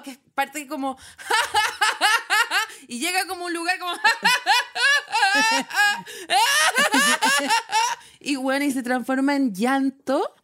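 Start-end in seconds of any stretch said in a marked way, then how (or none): 1.2-2.8 high demonstrated burst of silly laughter; stupid laughing fit. Shown as a demonstration. Showing co-host how the stupid laughing attack starts, high cackle. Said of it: "un ataque de risa estúpido". 4.0-7.9 high, long, escalating mock cackle; stupid laughing fit. Shown as a demonstration. Showing co-host where the laughing attack ends up, long high hysterical laugh. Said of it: "un ataque de risa estúpido".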